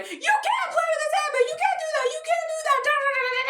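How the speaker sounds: very high, rapid squealed scolding; mock tirade, high and squealing; going off. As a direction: rant in a squeaky cartoon voice, ending in gibberish. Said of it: "really, really going off right now".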